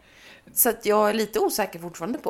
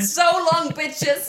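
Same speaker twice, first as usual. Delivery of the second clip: higher, loud gleeful farewell; mock dad fleeing; 7 semitones higher, 6 dB louder, much slower than usual. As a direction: cheer it while riding away. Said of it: "tar han flykten med gräsklipparen". (pappa flyr på gräsklipparen)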